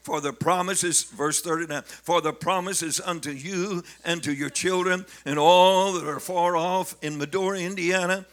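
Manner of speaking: full-force preaching of Acts; preaching scripture. Acted. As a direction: proclaim the verse to the congregation.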